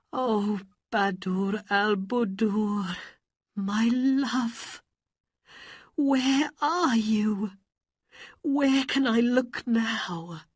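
high, plaintive lament; crying. A narrator's reading. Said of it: "sat by a river and cried".

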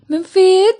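tearful cry for her beloved. Acted. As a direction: cry his name out in distress. Carol cries out his name.

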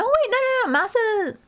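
high, urgent "wait, no" self-correction; sudden high-pitched correction. Real, not acted. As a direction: voice shoots way up. Hastily correcting own standings call mid-stream.

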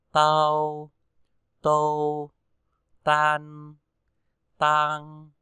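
ta, tơ read loud and clear one by one; t syllables drill. Shown as a demonstration. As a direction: model each t syllable for pupils to repeat. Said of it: "Và chúng ta ghép vào". (đọc mẫu ta tơ tai rõ và to)

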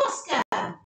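plain reading voice

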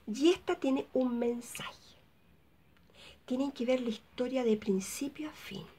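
slow, soft whisper with a hint of mystery; secretive whisper. Vlogger teasing the film's hidden message, slow whisper. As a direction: lean in and share the secret.

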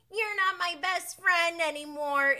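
put-on high, loud little-kid sulk; four-year-old. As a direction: pout it like a small child. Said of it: "My four-year-old says".

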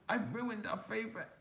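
little bear crying over the jumper. A narrator's reading. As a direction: sob it to your brother. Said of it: "Little Bear cried".

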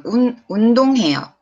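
운동해요 with the accent on 동; stress on second syllable. Shown as a demonstration. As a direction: lean on 동 in 운동해요, quick run. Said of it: "Accent is in the second one".

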